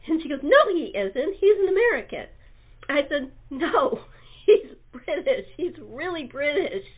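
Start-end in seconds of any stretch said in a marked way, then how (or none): 0.4-2.3 loud, stubborn retold insistence; mock the coworker's flat denial, louder; raised retold protest; insisting coworker. 3.5-6.8 laughing through a louder retold protest; laughing, half-exasperated correction; laughing insistence. Speaker insists, laughing at story.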